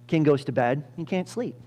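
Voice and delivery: normal talking voice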